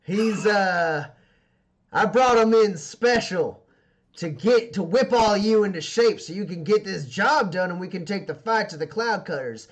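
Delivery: rally the troops like a tough gang boss; boss addressing crew. Acted. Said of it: "she says".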